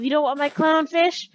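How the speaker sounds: loud incredulous question; mock indignation. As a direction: ask it loudly, as if affronted. Mock indignant question, first take.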